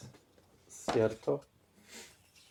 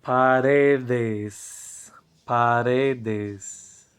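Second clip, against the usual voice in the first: Paredes said loud and drawn out; clear model answer; 4 dB louder, much slower than usual. Shown as a demonstration. Teacher models the plural paredes, slow and loud. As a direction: pronounce Paredes slowly for the class. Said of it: "¿Cuál es el plural de pared?".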